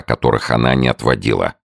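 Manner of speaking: plain reading voice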